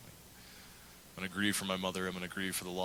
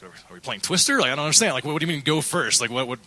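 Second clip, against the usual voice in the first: mock confusion; 6 semitones higher, 10 dB louder than usual. Pastor retells his confused reaction.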